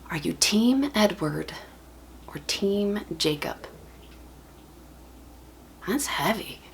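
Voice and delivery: slow, hushed dramatic question; weighty, soft and drawn out; hushed gravity. Acted. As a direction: let it drop almost to a whisper, with long pauses.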